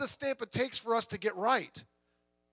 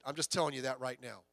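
usual speaking voice

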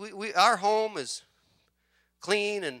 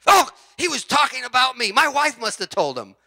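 mock indignant listener, a bit higher and louder; imitated churchgoer; 7 semitones higher, 8 dB louder than usual. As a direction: play the guy who thinks it's about him. Mocking a self-conscious churchgoer.

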